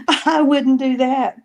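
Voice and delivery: laughingly refuse, pitch up; laughing recoil. Real, not acted. Reacting to sister touching the thing, laughing protest.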